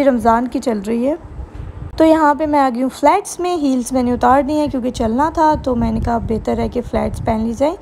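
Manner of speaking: ordinary talk, nothing marked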